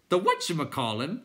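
clown creature voice. A narrator's reading.

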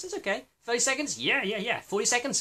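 excited rising praise. Real, not acted. Grading breath-hold times for viewers, excited high-pitched praise. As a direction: cheer each longer time on.

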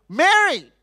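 Mary called high and loud; loud firm call; calling her name. Retold or imitated. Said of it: "Jesus had to go".

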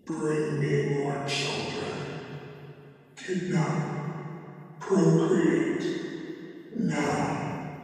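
very low, soft, menacing creature voice; commanding monster. Acted. Scarecrow ordering the couple to fetch children, deep and menacing.

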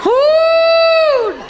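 long, loud, very high "woo"; high whoop. Acted. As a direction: let out a drawn-out whoop. Whooping after his own punchline.

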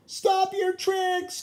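high, loud calling out. A narrator's reading. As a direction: yell at him to stop. Sam calls out.

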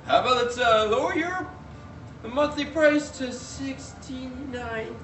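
woman-like guy. Retold or imitated. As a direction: squeak it up like the agent he took for a woman. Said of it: "this guy who I thought was a woman".

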